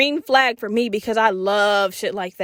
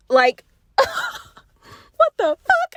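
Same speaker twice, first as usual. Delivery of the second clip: squealing, much higher, very slow through laughter; laughing squeal; much slower than usual. Real, not acted. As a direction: laugh it out in giddy disbelief. Disbelief at sharing homeroom.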